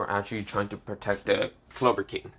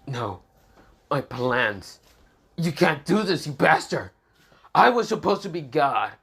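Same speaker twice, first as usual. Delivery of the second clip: rising to a higher, louder furious cry; angry outburst; 5 semitones higher, 4 dB louder than usual. Acted. Lucas rages at Asta over his ruined plans, furious. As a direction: rage at the ruin of your plans.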